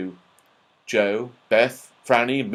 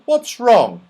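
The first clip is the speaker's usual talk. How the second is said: alarmed question; 10 semitones higher, 6 dB louder than usual. Acted. Joe alarmed by plane noise.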